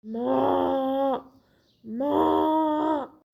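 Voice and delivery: long drawn-out moo shout; cow mooing. Acted. Cow bellowing at the calf eating her grass, drawn-out moo. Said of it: "चिल्लाने लगती है".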